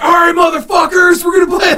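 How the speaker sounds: furious rock star shout; angry shout. Retold or imitated. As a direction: yell it like an angry rocker. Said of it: "he's all mad and stuff".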